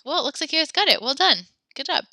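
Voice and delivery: high, loud, cheery congratulations; delighted praise. Real, not acted. Teacher praises the class.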